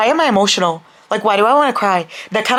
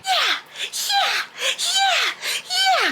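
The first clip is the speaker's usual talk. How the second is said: very high, soft squeak; a tiny, high-pitched cheering voice; squeaky cheer; 6 dB quieter, much slower than usual. Acted. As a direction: squeal the yeahs like a cartoon. Mock cheering while reading.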